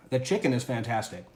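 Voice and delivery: ordinary talk, nothing marked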